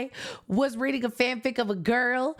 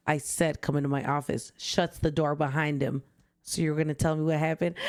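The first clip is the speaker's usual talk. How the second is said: cold doctor voice; 8 semitones lower than usual. Pretend. Fanfic doctor insists, door shut.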